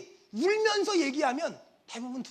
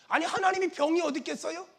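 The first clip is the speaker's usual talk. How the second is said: ordinary talk, nothing marked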